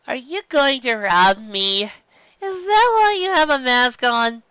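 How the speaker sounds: high, slow, near crying; high teary dopey voice; whiny drugged Luffy. Acted. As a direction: whine it like a scared loopy kid.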